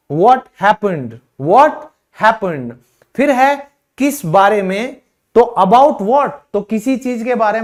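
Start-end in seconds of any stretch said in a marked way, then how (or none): none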